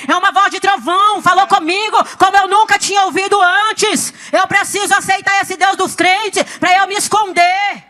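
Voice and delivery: high, forceful testimony, nearly shouted; impassioned pulpit proclamation; fervent preaching. Real, not acted. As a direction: drive each phrase home to the congregation.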